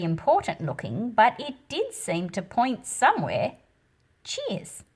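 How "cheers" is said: much higher, soft quick cheers; a boy thanking the cleaner; cheery thanks. A narrator's reading. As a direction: toss off a light thank-you. Narrator voices boy's reply.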